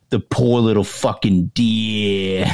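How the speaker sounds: slow, drawn-out teasing lament; mock pity. Prankster needling his panicking buddy, slow mock pity. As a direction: drag out the fake sympathy to needle him.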